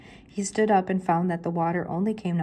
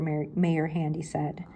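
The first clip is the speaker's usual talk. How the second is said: plain reading voice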